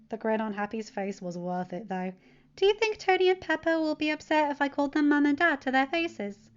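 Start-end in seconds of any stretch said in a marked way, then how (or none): 2.6-6.5 put-on high, louder, bright-eyed teen voice; cheerful teen. Teen telling his uncle about his weekend, cheerful and high. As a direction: bounce through it like an eager kid. Teen asks a hopeful question.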